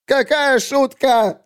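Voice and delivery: high, drawn-out wail; shrieked question. Acted. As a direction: shriek it in disbelief. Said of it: "завопил Бреш". (пронзительный возмущённый вопль)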